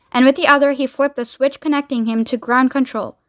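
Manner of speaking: usual speaking voice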